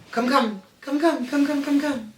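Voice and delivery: quick, high coaxing repeats; calling a dog. Real, not acted. Owner to Rico Suave, come here, high-pitched calling.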